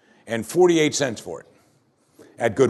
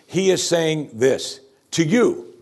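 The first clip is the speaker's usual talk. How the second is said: usual speaking voice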